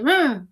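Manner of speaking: short, loud mock "mm"; husband's playful grunt, imitated. Husband teasing his sleepy wife, imitated loud grunt. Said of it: "y Ferit hace".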